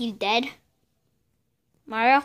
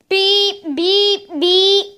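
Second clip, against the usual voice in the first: mock beeping; 4 semitones higher, 4 dB louder, much slower than usual. Imitating a beeping device.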